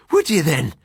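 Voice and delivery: a hint of Irish brogue, teasing the friend; Irish accent. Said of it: "my Irish doctor friend".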